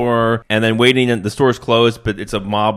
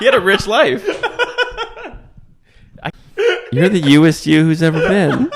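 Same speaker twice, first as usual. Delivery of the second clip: mock Mister Rogers; 7 semitones higher, 3 dB louder than usual.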